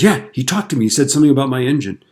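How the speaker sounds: high, loud, hurried confirmation; acted frantic insistence. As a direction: insist wildly, still freaked out. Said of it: "like, going crazy".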